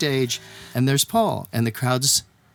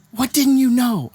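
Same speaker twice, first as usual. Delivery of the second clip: high, slow, exasperated question; imitated exasperation; 10 semitones higher, 4 dB louder, slower than usual. Star to apologizing friend, high-pitched exasperation. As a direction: throw it back high-pitched and drawn out.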